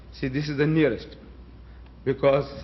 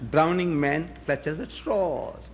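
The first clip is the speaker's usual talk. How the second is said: slow mocking proverb; 3 dB quieter, much slower than usual.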